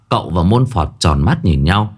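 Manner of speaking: usual speaking voice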